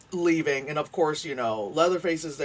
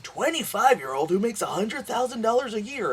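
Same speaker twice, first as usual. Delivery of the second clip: mock headline voice; 8 semitones higher than usual. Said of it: "those articles you see that are like".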